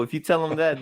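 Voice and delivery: normal talking voice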